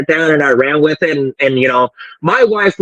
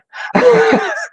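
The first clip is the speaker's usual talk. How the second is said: high-pitched laugh. Real, not acted. Laughs at own joke.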